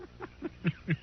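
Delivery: breathy scornful chuckle; short derisive laugh; mocking chuckle. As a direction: laugh him off under your breath. Spicer mocks the arrest.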